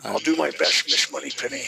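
roughly attempted Sean Connery accent. Shown as a demonstration. Showing a co-host how to do it, rough Sean Connery accent. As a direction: have a go at a Sean Connery accent, not quite landing it. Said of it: "do it in your best Sean Connery accent".

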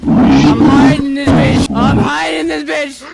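loud announcement. Real, not acted. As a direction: yell out your hiding place. Calling out while hiding in vehicle.

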